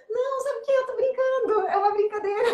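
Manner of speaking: high, loud, laughing through the words; a flustered woman laughing off her mistake to a boy; embarrassed laughing excuse. Retold or imitated. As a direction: cover the blunder while giggling.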